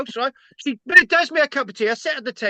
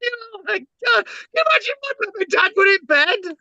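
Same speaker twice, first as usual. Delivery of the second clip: high, louder, voice breaking with emotion; half crying as he tells it; upset, near tears; 7 semitones higher, 4 dB louder, faster than usual. Real, not acted. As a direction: son distressed about his parents in bed. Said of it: "cry shout".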